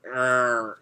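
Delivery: usual speaking voice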